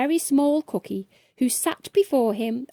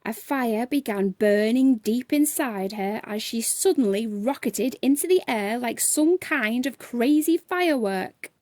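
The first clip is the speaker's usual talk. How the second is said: plain reading voice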